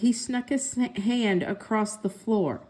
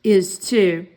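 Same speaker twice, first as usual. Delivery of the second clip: louder stubborn brother retort; bickering brother; 3 semitones lower, 6 dB louder than usual. A narrator's reading. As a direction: dig in like a stubborn boy. Siblings bickering over pencil.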